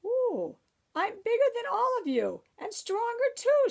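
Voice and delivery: high, bragging boast; boastful witch. A narrator's reading. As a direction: crow about her strength. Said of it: "she boasted".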